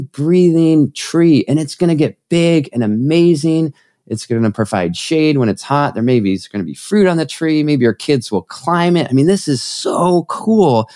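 high, stretched-out emphasis with mounting wonder; enthusiastic pitch. Real, not acted. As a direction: sell the vision with big, awed enthusiasm. Sells the vision enthusiastically.

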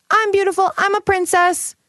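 put-on high, delighted little-girl voice; be a four-year-old admiring herself in the mirror; a proud small child preening in the mirror. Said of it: "who is four and looks in the mirror and is like".

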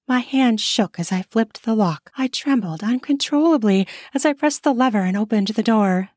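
plain reading voice

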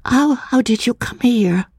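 a frightened woman stammering her question; startled woman asking. A narrator's reading. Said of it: "she asked".

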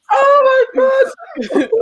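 high loud burst of laughter, then quick words; real laughing. Real, not acted. Co-host reminded of a forgotten film scene, real laughing outburst. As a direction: crack up, then talk through it.

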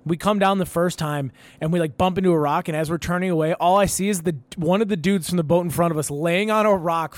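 normal talking voice

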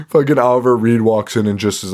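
normal talking voice

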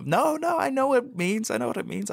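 high, strained insistence; mock high-pitched protest. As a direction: push the voice up in protest.

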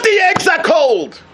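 high scornful shout; mocking shout. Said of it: "So what?".

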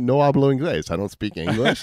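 retelling a traffic stop, laughing while quoting himself; laughing through it. Retold or imitated. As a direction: giggle out the fake Spanish. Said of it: "'cause I'm like".

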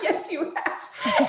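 slow, high, laughing reply; laughing agreement. Retold or imitated. Therapist confirming her past, slowly laughing. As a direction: drag it out through a laugh.

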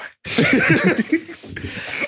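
high breathless silent laugh; wheezing laugh. Real, not acted. Laughing at food talk.

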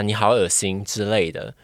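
ordinary talk

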